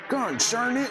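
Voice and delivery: put-on high, loud grumbling old-timer; old prospector. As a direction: bark it like a grizzled old prospector. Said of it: "he sounds like an old prospector".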